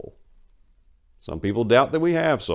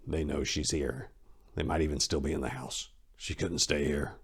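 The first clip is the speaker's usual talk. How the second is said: hushed inner thought; 4 semitones lower, 8 dB quieter than usual. A narrator's reading. Maria's frightened thoughts in the bloody room, hushed. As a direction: think it under your breath, afraid.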